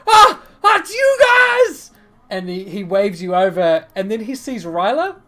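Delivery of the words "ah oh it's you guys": very high, loud delighted shout; excited greeting. Acted. Rock spotting his friends on the docks, excited shout. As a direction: holler to friends across the way.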